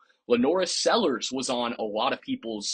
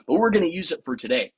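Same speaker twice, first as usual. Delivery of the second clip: usual speaking voice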